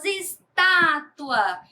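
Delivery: "estátua" slowly, TÁ stretched and strong; stress on TÁ. Shown as a demonstration. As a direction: hit and hold the TÁ of "estátua". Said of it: "o tá é pronunciado com mais intensidade". ("estátua" devagar, com o TÁ bem forte)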